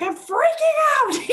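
high, loud, drawn-out panicked wail; freaking out. Retold or imitated. Client strapped to the cross, freaking out. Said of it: "and I freaked out".

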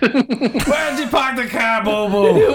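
high, slow Boston voice, car dropped to kah; Boston accent. Acted. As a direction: drawl it out like a Boston guy. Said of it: "what I was doing was a Boston accent".

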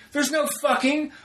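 high-pitched mock outrage; retold going off. Answering Sarah about the sticker, mock furious rant. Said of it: "And I'm just going off".

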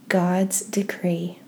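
ordinary delivery

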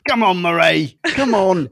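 broad, hammed-up Aussie griping; exaggerated Australian accent. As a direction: coax and grumble as a slangy Australian. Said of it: "needs her Australian accent".

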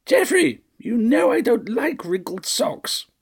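very high, scolding matron; shrill old lady. A narrator's reading. Fussy mistress nagging her butler, high and shrill. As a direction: shriek the name, then whine slowly.

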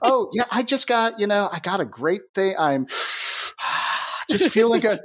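high, lively improvised voice with a sigh; put-on cheerful character. Game master covering for the wrong shopkeeper voice, high put-on cheer.

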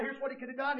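ordinary talk, nothing marked

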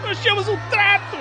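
high, loud pained scream. Acted. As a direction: scream it out, clutching the wound. Shot in the knee, screams. (gritando de dor)

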